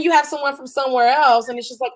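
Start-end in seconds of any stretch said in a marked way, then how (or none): none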